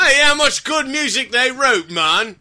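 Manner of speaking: loud, high, hammed-up British rock star; mock British rocker. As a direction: put on a shouty British rocker accent. Mock British rocker bit, "mate.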